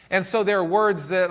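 normal talking voice

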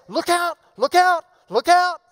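urgent repeated warning; triple warning. Acted. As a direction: look out thrice, each out loud and high. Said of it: "He's being triply redundant".

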